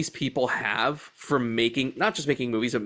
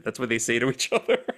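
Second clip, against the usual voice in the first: ordinary talk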